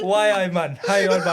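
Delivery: put-on laughing through a Geordie accent; a hint of Geordie, half laughing. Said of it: "Do your full pick now in a Geordie accent".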